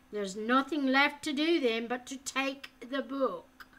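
harsh interrogator voice; menacing captor. A narrator's reading. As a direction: bear down on him with each word. Falconer orders.

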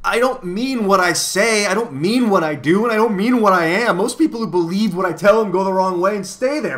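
high, loud acted wailing; hysterical crying. A narrator's reading. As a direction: wail it out as if in tears. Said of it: "crying hysterically".

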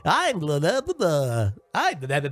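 sing-song mock DJ; light mock DJ intro; child mimicking DJ. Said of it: "mimicking her".

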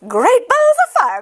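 very high, loud goose holler; excited cry. A narrator's reading. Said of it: "cried the goose".

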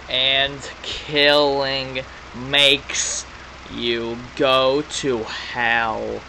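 slow, drawn-out villain voice; menacing villain. Acted. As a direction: read it as a sinister game villain. Voices villain's game text.

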